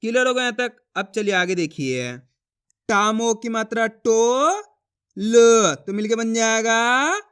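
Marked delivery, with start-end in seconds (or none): 2.9-7.2 slow long rising टो, pause, then a loud ल; stretched, emphatic teaching rhythm; letter-by-letter sounding out. Shown as a demonstration. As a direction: spell it out syllable by syllable for learners. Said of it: "इसी तरीके से पढ़ना पड़ेगा".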